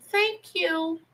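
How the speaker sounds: high, small aunt voice; little voice. Retold or imitated. Aunt pocketing uncle's money, high little voice. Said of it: "they had those little voices".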